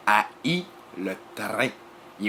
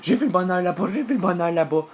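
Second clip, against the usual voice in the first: high, loud, frantic cry; crazed excitement; 8 semitones higher, 5 dB louder than usual. Acted. Jealous people who spotted happiness next door, crazed high cry. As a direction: cry it out like someone gone crazy. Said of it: "eux autres, ils viennent fous".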